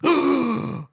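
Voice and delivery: high, theatrical gasp; dramatic gasp. Pretend. Storyteller reacting to the villain's name, dramatic gasp. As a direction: suck in air in mock shock.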